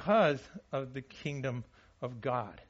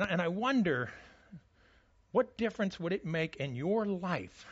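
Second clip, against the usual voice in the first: ordinary delivery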